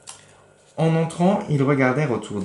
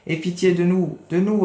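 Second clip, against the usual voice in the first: higher pleading cat voice; 8 semitones higher, 3 dB louder than usual. Acted. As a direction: beg in a high little cat voice. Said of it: "répéta le chat". (le chat supplie, voix aiguë)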